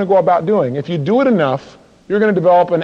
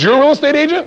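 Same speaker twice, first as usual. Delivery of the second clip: high, challenging doorstep question; acted skeptic, pitched way up; incredulous homeowner; 9 semitones higher, 4 dB louder than usual. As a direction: pounce on the caller in disbelief. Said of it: "and the person goes".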